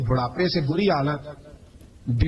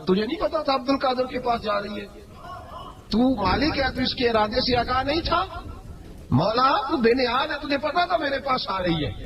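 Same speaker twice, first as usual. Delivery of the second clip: rising plea; 8 semitones higher than usual. Retold or imitated. Preacher pleads with God.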